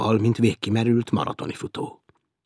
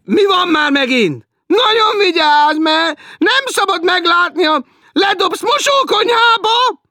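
much higher, loud and slow nagging; shrill teasing woman; 13 dB louder, slower than usual. Acted. Woman below teasing Martin, shrill and slow. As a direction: drag it out like a shrill landlady.

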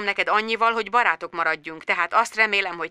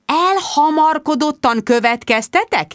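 indignant echo; 8 semitones higher, slower than usual. A narrator's reading.

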